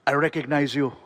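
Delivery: put-on deliberate Austrian-accented star voice; Arnold Schwarzenegger. Star recognising the comedian, Austrian impression. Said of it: "and he's like".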